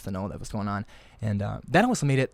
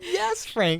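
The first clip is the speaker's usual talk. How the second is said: excited reply. Retold or imitated. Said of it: "I'm like".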